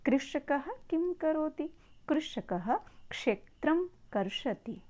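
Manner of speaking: slow recitation with curled-back ष in कृषकः and कर्षति; careful teacher's demonstration, ष retroflex in कृषकः; model Sanskrit pronunciation. Shown as a demonstration. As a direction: pronounce कृषकः क्षेत्रं कर्षति slowly, tongue twisted back for ष. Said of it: "तो इससे उच्चारण सही निकलता है".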